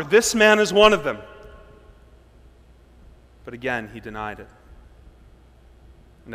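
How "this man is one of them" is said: higher, louder pointed accusation; accusing servant girl. Acted. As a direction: call him out to the crowd.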